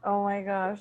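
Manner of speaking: ordinary talk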